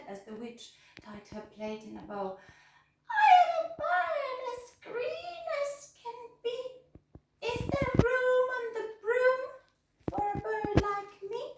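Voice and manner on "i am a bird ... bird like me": much higher squawky bird voice; green bird. A narrator's reading. Bird asks the witch for room. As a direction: chirp it like an excited bird. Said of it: "then said".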